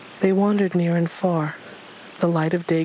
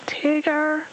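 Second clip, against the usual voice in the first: calling out; 12 semitones higher than usual. A narrator's reading. The stranger calls after vanished Tigger, calling out. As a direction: call a name off into the distance. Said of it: "the stranger yelled off in the direction".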